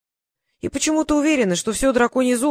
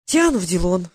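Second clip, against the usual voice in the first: ordinary delivery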